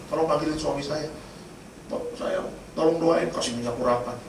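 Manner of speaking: hushed imitation of an anxious request; retold quiet plea. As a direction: quote it gently, voice dropped. Wife's plea before surgery, retold.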